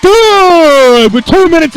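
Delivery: loud high shout; excited call. Real, not acted. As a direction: belt out the kick call. Field goal is good.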